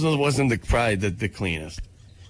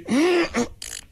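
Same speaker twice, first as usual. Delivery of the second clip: sharp gasp; 11 semitones higher than usual. Pretend. Pretend back crack.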